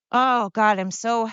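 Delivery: ordinary delivery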